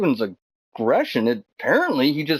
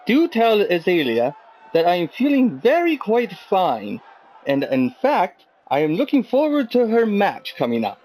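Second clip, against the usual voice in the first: hammed-up mock British accent; very bad British accent; 2 semitones higher than usual. Interviewer relays a text to [name] as ordered, very bad British accent. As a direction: put on a badly faked British accent. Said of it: "I actually spoke in the very bad accent".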